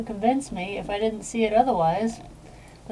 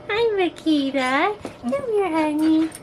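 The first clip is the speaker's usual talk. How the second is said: higher sing-song pet voice; 6 semitones higher than usual. Real, not acted. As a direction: coo sweetly at the puppy. Baby talk to the dog.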